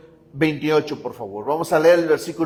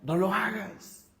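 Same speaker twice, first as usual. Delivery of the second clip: softer, slow gentle plea; wise wife; 4 semitones higher, 5 dB quieter than usual. Pretend. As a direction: quietly talk your husband out of it. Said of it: "La esposa sabia dice".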